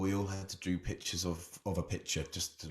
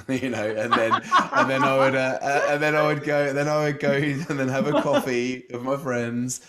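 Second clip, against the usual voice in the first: giggling through the words, louder and higher; laughing; 7 semitones higher, 10 dB louder than usual. Real, not acted. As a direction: laugh it out, can hardly get the words through. Laughing while telling the Bali dream.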